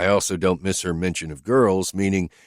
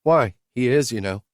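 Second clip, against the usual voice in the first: higher, lightly voiced woman asking bluntly; higher woman's voice, questioning her driver; Whitney's voice; 6 semitones higher, 5 dB louder than usual. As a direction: lift into her voice, direct and searching.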